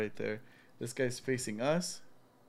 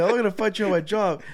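nervous tour guide; 7 semitones higher, 8 dB louder than usual. Retold or imitated. Tour guide refusing, repeated.

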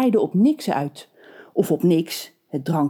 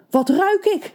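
high eager baker; drooling baker; 12 semitones higher than usual. A narrator's reading. The hungry baker sniffing the kettle, high and eager. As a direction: stammer it, mouth watering. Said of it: "stamelde de bakker watertandend".

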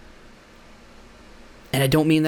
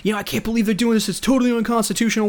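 upset rant; 7 semitones higher, faster than usual. Retold or imitated. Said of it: "getting all upset and saying".

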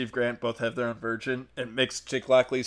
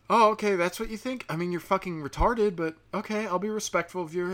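higher-pitched, feigned open-mindedness; put-on tolerant listener voice; mock politeness; 6 semitones higher than usual. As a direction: start high, then soften into forced courtesy. Acting out his polite listening.

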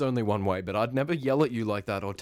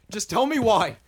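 louder, higher, pushing harder; angry demand; 7 semitones higher, 7 dB louder than usual. Acted. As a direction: repeat it, more insistent. Pressing her for an answer.

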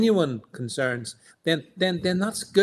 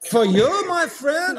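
high-pitched mock Schwab impersonation; Klaus Schwab; 10 semitones higher, slower than usual. Co-host showing off his Klaus Schwab impersonation, high and hammed-up. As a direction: put on a high Schwab voice for a friend. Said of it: "I could do my Klaus Schwab impersonation".

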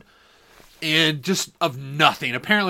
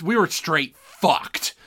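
usual speaking voice

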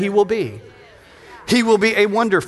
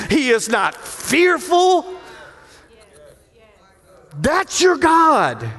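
full-force preaching; 2 semitones higher, slower than usual. Acted. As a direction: drive each line home to the congregation.

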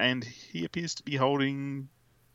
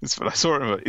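ordinary talk

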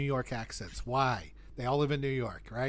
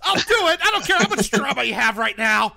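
put-on high, loud yell at the kids; full-volume, high-pitched outburst; mock angry shout; 12 semitones higher, 8 dB louder than usual. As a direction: shout it like an exasperated parent.

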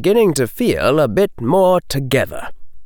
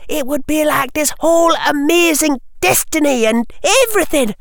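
put-on high, enthusiastic girlish voice; eager schoolgirl. Lavender defending wanting extra powers, eager. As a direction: play a young witch longing to be special, pitched high.

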